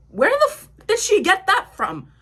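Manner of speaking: high, loud cry of disbelief; acted outrage. Retelling her outraged reaction to accusation.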